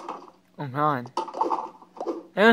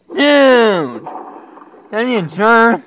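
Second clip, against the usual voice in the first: mock annoyed shout; 5 semitones higher, 10 dB louder, much slower than usual. Annoyed at a character.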